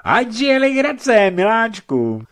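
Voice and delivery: high, loud tipsy toast; slow, high cheer to a drinking partner; toasting woman. Acted. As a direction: raise a glass in a high put-on voice. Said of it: "pozdvihovala žena svou sklenici se slovy".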